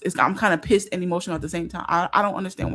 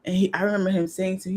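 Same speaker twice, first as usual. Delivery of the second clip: normal talking voice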